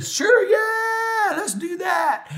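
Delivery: high-pitched, hammed-up excited yell; exaggerated gleeful shout; eager dad imitation. Imitating dad's eager reply.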